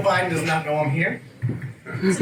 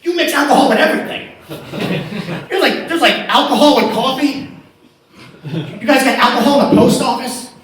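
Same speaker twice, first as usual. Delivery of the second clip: louder, higher comic rant; excited rant; 5 semitones higher, 6 dB louder than usual. Real, not acted. Comedian ranting loudly to English crowd about alcohol. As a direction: pile the outrage up.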